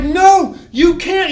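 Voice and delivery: high, loud protest; panicked yelling. Retold or imitated. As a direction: imitate terrified students yelling. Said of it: "they would start yelling at me".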